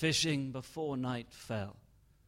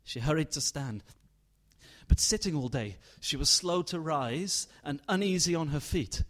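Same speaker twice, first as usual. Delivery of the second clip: ordinary delivery, nothing marked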